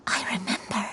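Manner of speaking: breathy half-whisper as the memory surfaces; hushed whisper. Acted. Grandmother to granddaughter Shuyu as the memory returns, whispered. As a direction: let the words slip out on almost no voice. Grandmother recalling a painful past.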